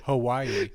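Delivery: Hawaii said with lips apart, the w only breathed; lips not touching. Shown as a demonstration. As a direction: let Hawaii slide out without the lips closing. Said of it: "My lips don't touch".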